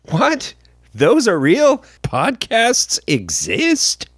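high, slow fake astonishment; mock disbelief. Host mocks the commenter, gasping in mock disbelief. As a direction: play dumb about podcasts existing.

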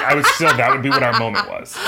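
ordinary delivery

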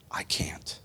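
whispered I can't. Acted. Praying sinner to God, whispered. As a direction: breathe it like a confession.

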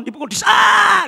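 hammed-up pain yell; pain cry. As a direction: howl as if hit. Acting out getting hit.

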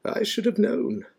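high-pitched amused remark to oneself; a touch of a chuckle in it; amused muttering. A narrator's reading. Dumbledore amused by the cat, a lightly amused aside. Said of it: "He chuckled and muttered".

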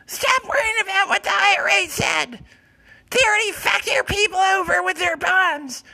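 very high, loud cat screech; high loud catlike meowing; shrieking cat voice. Acted. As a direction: yowl like a deranged cat doctor. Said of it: "why does your voice sound all fucked up".

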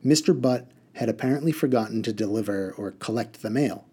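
ordinary delivery

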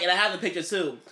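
ordinary talk, nothing marked

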